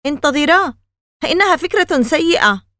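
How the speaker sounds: high, loud protest; Danny protests to his friends that the prank is a bad idea; objecting. A narrator's reading. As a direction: stop them in alarm. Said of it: "اعترض داني".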